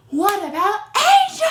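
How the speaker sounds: high, loud shout at the screen; exasperated shout. Real, not acted. Vlogger shouting at the lady who forgot the girl. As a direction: yell it out, fed up. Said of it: "I'm just like".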